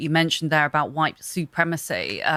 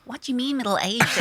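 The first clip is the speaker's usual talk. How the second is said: lightly put-on American accent, higher-pitched; a hint of American in a raised voice; husband's American accent; 7 semitones higher than usual. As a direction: mimic an indignant American, pitched up. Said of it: "in his American accent".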